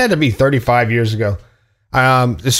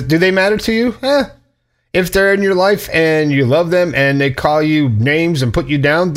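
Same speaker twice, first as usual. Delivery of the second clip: normal talking voice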